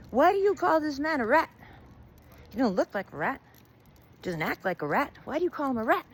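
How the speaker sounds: high, loud questioning rat; indignant rat. A narrator's reading. Rat confronts the old woman about the name, indignant. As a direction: demand an explanation, pitched high.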